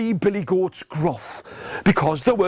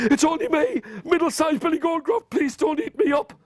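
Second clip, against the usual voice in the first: high, pleading goat voice; frightened middle-sized goat; 7 semitones higher than usual. Acted. Middle goat begging the troll, high frightened voice. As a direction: beg in a raised, anxious voice. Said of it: "he quaked and he quivered and said".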